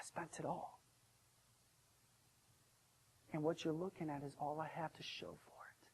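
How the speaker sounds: soft, subdued and regretful; quiet confession. Acted. Young son tells the servant his story, quietly ashamed. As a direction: confess it softly, without pride.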